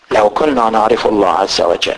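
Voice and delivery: ordinary delivery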